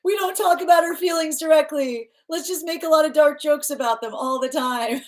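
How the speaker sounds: high, airy mock voice of the couple; lightly voiced sarcastic couple. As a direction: play the couple, breezy and ironic. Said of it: "voicing the couple".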